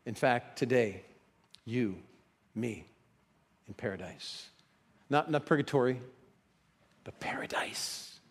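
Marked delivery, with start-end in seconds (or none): 0.1-4.5 tender promise. Retold or imitated. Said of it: "Jesus says". 7.0-8.2 hushed whisper on paradise; whispered emphasis. Real, not acted.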